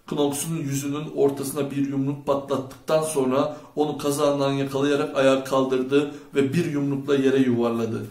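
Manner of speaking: ordinary delivery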